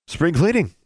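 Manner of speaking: high, chirpy announcement; cheerful. A narrator's reading. Custodian handing the student a shovel, cheerful. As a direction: sing it out cheerfully. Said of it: "she said cheerfully".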